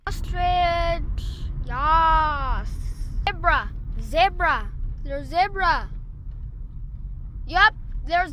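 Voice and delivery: shouting out each animal she spots; loud, thrilled sighting calls; excited calling out. Real, not acted.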